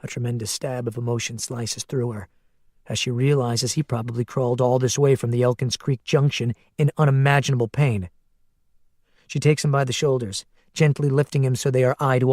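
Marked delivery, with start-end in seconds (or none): none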